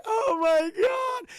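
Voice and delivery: high, loud, drawn-out wail; mock crying mom. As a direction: wail it out like a sobbing mother. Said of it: "she starts crying".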